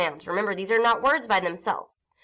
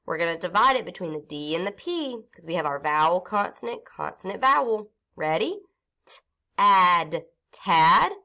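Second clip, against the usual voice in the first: normal talking voice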